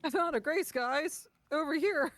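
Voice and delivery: high, loud excited call. Retold or imitated. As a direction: shout it like you found treasure. Re-enacts excited friend calling out.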